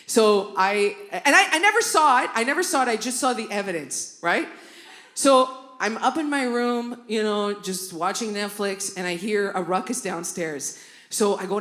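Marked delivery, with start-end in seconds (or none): none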